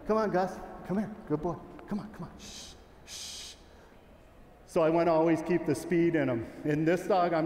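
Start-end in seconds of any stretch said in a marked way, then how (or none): 0.1-1.6 calling the dog. Real, not acted. Calling his dog Gus over. 1.9-3.5 soft whispered coaxing, then a hush. Real, not acted. Handler whispering to his dog to settle him, whispered hush.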